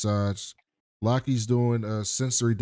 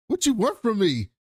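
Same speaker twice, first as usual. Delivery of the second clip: higher, exasperated brush-off; pitched-up imitation of a careless mom; mock dismissive mom; 10 semitones higher than usual. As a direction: toss it off like a fed-up mother.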